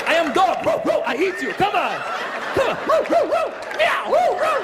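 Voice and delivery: very high, loud manic dog woofs; hammed-up frantic shouting and yapping; crazed fan barking. Fan acting a dog at the comedian, manic and high. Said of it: "this guy just lost his mind".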